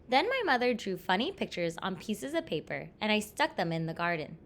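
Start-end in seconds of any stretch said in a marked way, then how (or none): none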